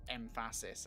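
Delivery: EMPHASIS said as "em-FASS-is"; imitated mispronunciation. Co-caster quotes the odd word back, emphasis as "em-FASS-is". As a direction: stress the second syllable of EMPHASIS, em-FASS-is. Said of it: "about to rip you for saying".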